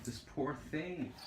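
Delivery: soft, slow, pitying words to a pencil; mock pity. Pitying a pencil she's about to throw.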